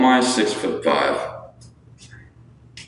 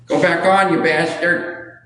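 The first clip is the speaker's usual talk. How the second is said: cuss out the light in a raised pitch; mock scolding; 10 semitones higher than usual. Real, not acted. Cursing at a light that went off.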